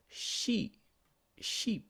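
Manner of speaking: Sheep said high with a long ee; long E demo. Shown as a demonstration. Teacher contrasting Sheep for students, long ee. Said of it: "Cái âm T làm cho nó ngắn lại".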